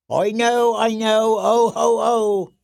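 high, loud jester chant; sing-song fool voice. Acted. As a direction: prance it like a court fool.

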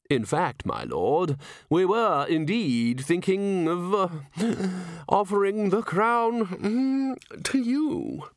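slow, high, affected deference; oily, hesitant courtier. Acted. A chair offers Nobby the crown, hesitant and unctuous. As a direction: creep up to the offer with pauses.

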